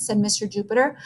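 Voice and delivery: ordinary delivery, nothing marked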